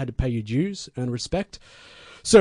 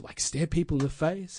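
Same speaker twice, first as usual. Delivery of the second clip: usual speaking voice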